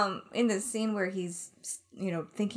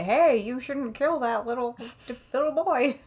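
lightly acted higher, louder protest; a hint of pleading, half laughing; playful pretend plea to stop someone; mock intervention; 6 semitones higher, 4 dB louder than usual. Acts out a hypothetical pleading passer-by.

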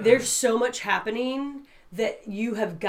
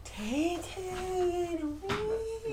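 soft, high, slow chant; Nell; 5 semitones higher, 11 dB quieter, much slower than usual. Pretend. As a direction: croon the nonsense words high and quiet. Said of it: "why do you sound like Nell".

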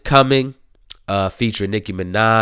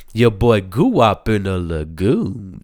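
ordinary talk, nothing marked

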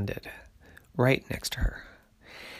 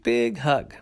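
ordinary delivery, nothing marked

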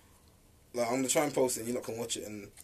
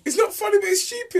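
high giggly protest; squeaking it out mid-laugh; 14 semitones higher than usual. Real, not acted. Laughing while being scolded.

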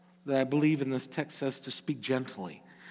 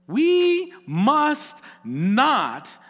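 WE MUST NOT, each word drawn out high and loud; emphatic exhortation; 10 semitones higher, 6 dB louder, much slower than usual. Real, not acted. Preacher warning those who call themselves Christians, "we must not" slow and emphatic.

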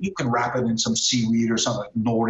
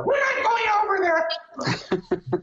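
very high, slow mock shriek; indignant wife; much slower than usual. Wife refusing to visit that restaurant, indignant shriek. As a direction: squawk the refusal in a hammed-up high voice.

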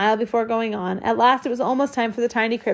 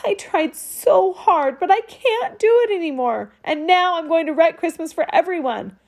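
put-on tearful boy; 6 semitones higher than usual. A narrator's reading. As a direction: let the tears nearly break through. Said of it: "he burst into sobs".

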